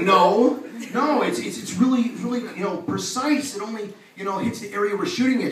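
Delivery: high, insistent reassurance; flustered denial. Retold or imitated. Doctor answering wife's weight-loss question, flustered denial. Acted hurried denial to wife.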